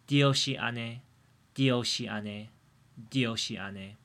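"dioshiane" said three times with a D onset; repeated pronunciation drill. Shown as a demonstration. Modelling the word for podcast listeners, repeated.

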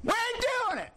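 high, ringing defiance; high, firm judge's declaration; defiant proclamation. Retold or imitated.

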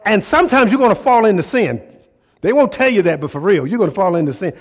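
loud, high, fired-up preaching; full-force preaching. Real, not acted. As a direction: preach it hard to the crowd, almost shouting.

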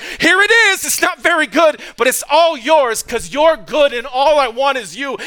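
high, loud, pleading cry to God; impassioned surrender. Acted. Worshipper giving his whole life to God, impassioned surrender. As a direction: hand it all over at full voice.

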